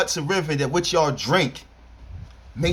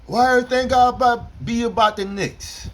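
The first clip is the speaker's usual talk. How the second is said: mocking whiny Knicks fan; 6 semitones higher than usual. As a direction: poke fun at a complaining fan. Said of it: "this is how they talk".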